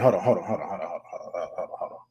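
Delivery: trailing off into a breathy whisper; fading whisper. Real, not acted. Storyteller pausing his audience, fading to a whisper. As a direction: let it die away to almost nothing. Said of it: "stay with me, y'all".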